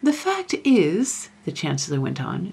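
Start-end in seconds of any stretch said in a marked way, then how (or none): 0.0-1.3 high, slow uneasy opening; agitated. A narrator's reading. Chancellor twists his beard as he explains, agitated. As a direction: begin an awkward disclosure, agitated. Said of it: "in an agitated way".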